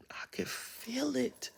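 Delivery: slow hushed whisper. Shown as a demonstration. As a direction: breathe it out in awe. Repeated, then whispered.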